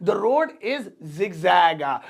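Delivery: drawn out word by word; slow it right down for learners to repeat; very slow model sentence. Shown as a demonstration. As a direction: dictation pace. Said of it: "slower repeat".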